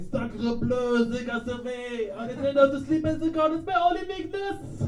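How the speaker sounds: high, hammed-up French accent. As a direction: ham up a French villain. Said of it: "this middle-aged guy going".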